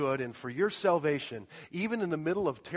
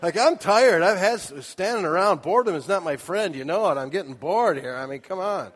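loud mock whine; 3 semitones higher, 7 dB louder than usual. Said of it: "acting bored husband".

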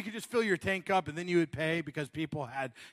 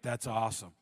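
distracted husband; 6 semitones lower, 4 dB quieter than usual. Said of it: "You're like".

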